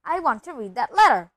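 loud, insistent demand. A narrator's reading. Son demanding the letter from his father, loudly. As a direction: insist at full voice. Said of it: "he said loudly".

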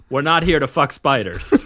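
hammy Australian through laughter; broad Australian accent. Acted. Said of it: "my personal favorite".

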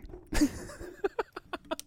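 breathless unvoiced high laugh; silent wheeze laugh. Real, not acted.

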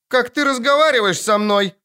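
high, indignant little princess; haughty child. A narrator's reading. Girl rebukes Geralt for his tone, haughty and indignant. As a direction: pipe up proudly, affronted. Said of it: "гордо подняла голову и топнула ножкой".

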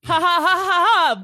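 fake laugh.

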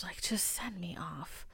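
whispered aside; whispered just send me off. Real, not acted. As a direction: drop to a whisper for the dark joke. Dark joke about euthanasia.

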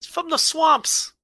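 high-pitched comic protest; mock indignation. Co-host protesting that Steve Kern is from Florida, mock-indignant. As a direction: pitch it way up in exasperated disbelief.

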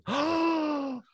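high, slow, drawn-out gloating taunt; sing-song mock triumph; mock gloating wife. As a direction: stretch it out to rub it in. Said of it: "And she goes".

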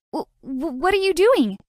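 stammering, then jumps high; flustered stammer. Acted. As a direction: caught off guard, stutter it out. Flustered when suddenly carried.

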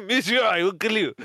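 high mock French accent; Peter. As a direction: put on a heavy French accent. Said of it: "in his heavy French accent".

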